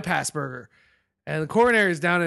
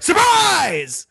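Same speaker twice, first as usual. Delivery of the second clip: mock surprise; 11 semitones higher, 6 dB louder than usual.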